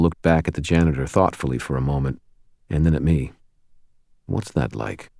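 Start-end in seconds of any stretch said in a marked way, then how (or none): 4.3-5.1 soft, lower curious question; quiet question. A narrator's reading. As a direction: ask it gently and low. Michael asks quietly.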